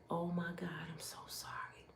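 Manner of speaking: shocked hushed whisper; whispered apology. Retold or imitated. Nurse hearing the husband's cruel words, stunned whisper. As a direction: breathe it out aghast, barely audible.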